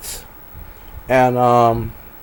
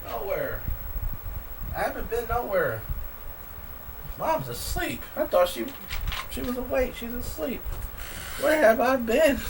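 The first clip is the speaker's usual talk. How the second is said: quiet; 2 semitones higher, 16 dB quieter than usual. Real, not acted. Mom's asleep.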